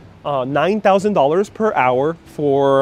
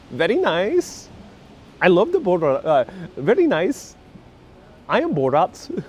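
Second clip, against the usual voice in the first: higher-pitched mock-foreign character voice; comic accented catchphrase, swooping high; Borat; 4 semitones higher than usual. Streamer playing Borat introducing himself, higher-pitched comic accent. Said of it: "Yes, Borat".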